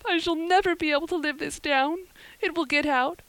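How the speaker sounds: put-on high, wailing, distraught girl's voice; sobbing girl. A narrator's reading. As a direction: pour out the misery in a high acted wail. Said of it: "sobbed Anne".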